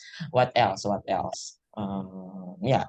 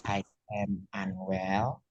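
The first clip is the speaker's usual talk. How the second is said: slow, softer, drawn-out words; slow model phrase; 3 semitones lower, 6 dB quieter, much slower than usual. Shown as a demonstration. Teacher giving learners another option, slow and soft. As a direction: stretch each word for learners to copy.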